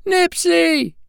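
very high, loud calling; eager little bird call; crying out. A narrator's reading. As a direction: call your brother's name, long and piping. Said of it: "cried Pipsy".

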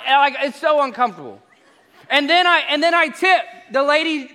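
ordinary talk

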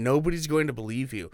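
ordinary delivery

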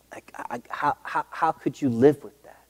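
breathless whispered how; words catching in a hushed throat; whispering, almost too moved to speak; emotional whisper. Real, not acted. Overcome telling sad story.

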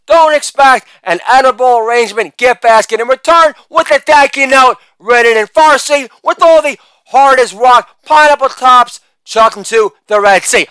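ordinary talk

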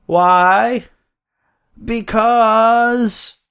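high, drawn-out call and answer; catchphrase call. Acted. New Day answers Ambrose with their catchphrase, high showy build-up. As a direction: stretch it out high like a crowd-rousing build-up.

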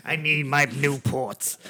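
slow, raspy smoker's drawl; gravelly voice. Pretend. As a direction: croak it like a chain smoker. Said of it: "In a smoker's voice".

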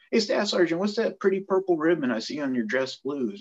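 captain's pointed question. Retold or imitated. Captain asks staff sergeant about his ribbon, pointedly calling out. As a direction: lift it high, as if calling him out.

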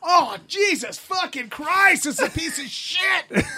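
high, slow drawn-out groaning; hammed-up agony at a terrible film; mock exasperation. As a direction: wail it out like a suffering audience member. Re-enacts groaning through awful movie.

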